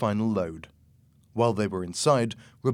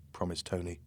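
ordinary delivery

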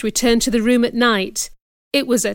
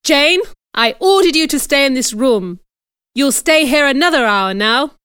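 stern, raised-voice order; louder and higher, laying down the law; demanding; 4 semitones higher, 5 dB louder than usual. A narrator's reading. Aunt orders the child to stay locked in, demanding. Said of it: "demanded Missus Reed".

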